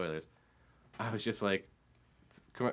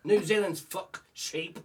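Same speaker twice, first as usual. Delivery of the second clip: slow Irish-Australian accent; 7 semitones higher than usual. Shown as a demonstration. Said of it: "Irish accent, Australian words".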